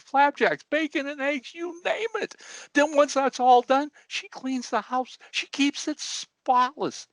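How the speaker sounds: high, blubbering old man, hammed-up; old man mock-weeping his story, pitched high; crying old man. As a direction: tell it through mock tears in a high voice. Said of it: "And he's still crying".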